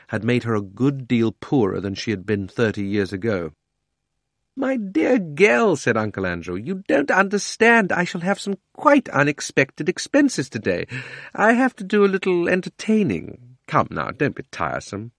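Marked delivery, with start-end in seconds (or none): 4.5-5.8 high, slow, patronising uncle; wheedling uncle. Acted. Uncle patronisingly coaxes his sister. 6.6-15.1 high-pitched, pompous, coaxing uncle; wheedling uncle. Acted.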